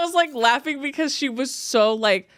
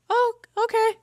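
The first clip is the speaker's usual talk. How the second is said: much higher, startled little oh okay; high surprised; 13 semitones higher than usual. Retold or imitated. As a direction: squeak it out, caught off guard. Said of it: "I go".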